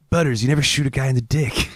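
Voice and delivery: cartoon kid voice. Mock South Park Cartman voice.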